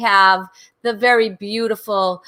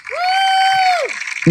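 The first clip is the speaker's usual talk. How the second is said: high whooping woo; cheer; 3 dB louder than usual. Real, not acted. Host whoops as the guest comes on stage, a high woo.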